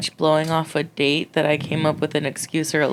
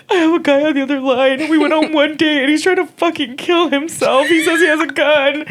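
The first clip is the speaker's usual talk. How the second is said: crying; 10 semitones higher than usual. Retold or imitated. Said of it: "And I'm, like, crying".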